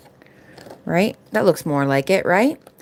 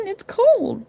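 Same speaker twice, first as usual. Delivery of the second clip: much higher, whiny complaint; high whine; 13 semitones higher than usual. Real, not acted. Complaining about the cold.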